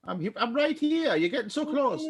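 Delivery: high-pitched Gretel in a bad German accent; French-sounding German Gretel. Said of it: "being a French Gretel".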